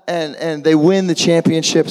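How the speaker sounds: usual speaking voice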